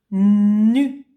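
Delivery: Na said loud and clear; dictation. Shown as a demonstration. Teacher dictating a syllable to the child, loud and clear. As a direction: pronounce Na for dictation. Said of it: "tu écris nu".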